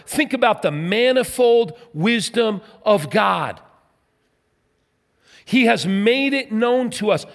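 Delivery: rousing sermon delivery; fervent preaching. Acted. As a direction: proclaim it to the whole congregation. Preaching at full force.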